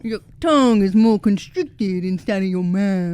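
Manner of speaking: hammed-up Kentucky twang; Kentucky accent. Shown as a demonstration. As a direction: imitate a Kentucky accent with a tight tongue. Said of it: "a little bit more from Kentucky".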